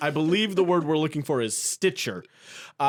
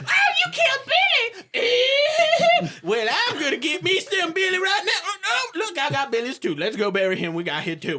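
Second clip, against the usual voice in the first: much higher squealing yokel; mock townsfolk. Game master to players, squealing villager voices.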